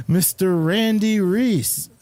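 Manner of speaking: mock grand welcome. Host greets guest with fanfare.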